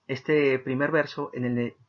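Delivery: ordinary talk, nothing marked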